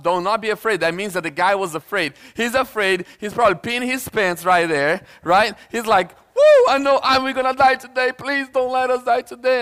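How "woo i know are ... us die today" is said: high, loud mock panic; hammed-up freak-out, starting on a whoop; panicking. Preacher mimicking the terrified servant pleading, comic panic. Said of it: "all panicking and freaking out".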